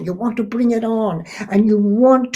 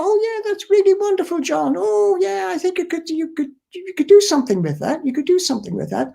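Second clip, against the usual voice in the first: much higher, sugary encouraging voice; gushing family praise; 12 semitones higher than usual. Retold or imitated. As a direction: coo over John's idea like a doting relative. Family's flattering praise of John.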